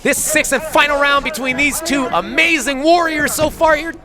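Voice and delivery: rising, shouting final bell call; excited play-by-play. Real, not acted. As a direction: build to the last bell. Commentator calls final bell.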